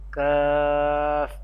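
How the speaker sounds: "Kaf" with a long held vowel, Arabic accent; Arabic letter name. Shown as a demonstration. Teacher ending the Ikhfa letter list, Arabic drawn-out "Kaf". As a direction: draw "Kaf" out on one level note. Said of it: "Ikhfa letters in an Arabic accent".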